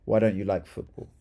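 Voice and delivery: normal talking voice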